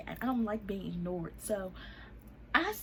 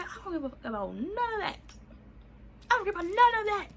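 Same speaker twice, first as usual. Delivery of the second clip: high-pitched angry dismissal, building louder; heated retort; 11 semitones higher, 4 dB louder than usual. Retold or imitated. Answering his excuse about baby shower, angry and high. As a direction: shut the excuse down, rising into a yell.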